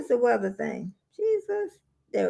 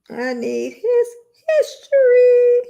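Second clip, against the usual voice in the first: drawn-out whine; 11 semitones higher, 6 dB louder, slower than usual. Real, not acted. Repeats, stretching the last word.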